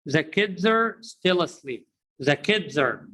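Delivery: put-on "kids are" as one word, "kidzer"; linked reduced are. Shown as a demonstration. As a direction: shrink "are" to R on the end of "kids". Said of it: "We will reduce it to R".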